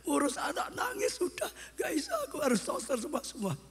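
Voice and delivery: high, wailing imitation of an overwhelmed mother; young mother complaining. Preacher imitating young mothers who can't cope, high mock complaint. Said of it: "Apalagi ibu-ibu yang muda sekarang".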